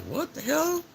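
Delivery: high, startled what the hell; stunned exclaim. Retold or imitated. Reacting to her girlfriend's curt answer, high and stunned. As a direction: sound floored by the reply. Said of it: "I'm like".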